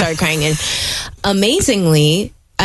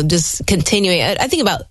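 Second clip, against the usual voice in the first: normal talking voice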